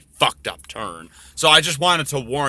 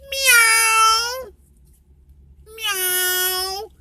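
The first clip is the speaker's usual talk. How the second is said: much higher cute cat voice; kitten meow. Retold or imitated. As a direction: mew like a tiny kitten. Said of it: "It goes".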